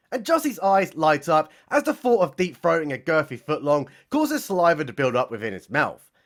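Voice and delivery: normal talking voice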